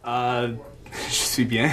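English-speaker accent in French; hesitant learner French with English accent; foreign accent in French. Real, not acted.

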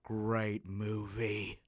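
very slow, weighty pronouncement; drawn-out verdict. Retold or imitated. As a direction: stretch each word right out as the tipsy neighbour. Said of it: "looks at me for a while and he goes".

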